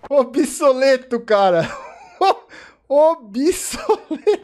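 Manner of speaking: laughing it out, breaking up over "Obsoleto"; can't stop laughing as you say it; real cackling laugh through the word. Real, not acted. Mocking the robot, cracking up.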